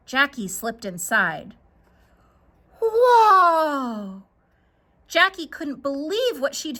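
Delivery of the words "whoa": loud, high amazed cry; a child stunned by a castle of treasure; amazed whoa. Acted. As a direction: gasp it out in wonder.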